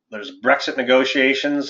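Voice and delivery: ordinary talk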